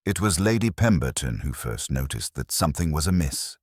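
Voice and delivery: ordinary delivery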